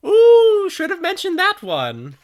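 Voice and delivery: high-pitched, loud radio character. Acted. Radio cuts in on the players, high loud radio voice. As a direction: pipe up loudly in a high put-on voice. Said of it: "the radio crackles back on and says".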